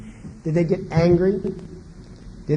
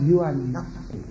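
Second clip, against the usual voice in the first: dismissive parent; 7 semitones higher than usual. Retold or imitated. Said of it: "that's saying to the child".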